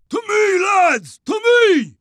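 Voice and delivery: high rallying holler; rally the men from afar. A narrator's reading. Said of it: "waved and hollered out".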